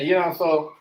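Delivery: ordinary talk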